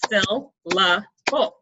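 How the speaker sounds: "syllable" said very slowly, one beat per clap; syllables clapped out. Shown as a demonstration. Teacher clapping a word's syllables with the class, very slow. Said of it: "So you clap out the syllables".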